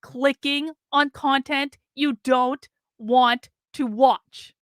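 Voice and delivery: slow, word by word, for emphasis; slow emphatic. Real, not acted. Streamer telling complainers how algorithms work, slow and emphatic. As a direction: spell it out slowly, pausing between words.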